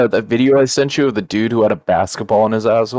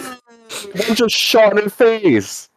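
much higher, slow, stunned report; appalled shock; much slower than usual. Real, not acted. Narrating the video live to friends, slow and appalled. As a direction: drag it out, high and horrified.

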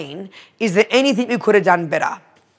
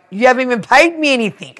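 quick, higher, louder objection; mock outraged accountant; indignant protest; 7 semitones higher, 6 dB louder, faster than usual. Retelling the accountant's answer to being fired, indignant.

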